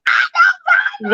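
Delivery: gushing fan who can't contain herself; excited fangirl shout. Retold or imitated. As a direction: squeal it way up high and loud. Fangirling, claiming to know Bobby.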